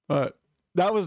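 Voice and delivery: normal talking voice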